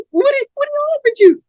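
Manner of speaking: very high-pitched, stammered question; alarmed woman's voice. Acted. Apollonia asking Cold Blood about the bet, high-pitched alarm. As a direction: play the worried woman way up high.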